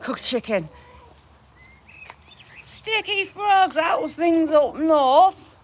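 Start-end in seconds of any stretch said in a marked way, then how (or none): 2.6-5.6 mock Yorkshire. Reading Sticky Frog's comment aloud in mock Yorkshire. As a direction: ham up a Yorkshire accent, drawn out. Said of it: "he speaks Yorkshire perfectly".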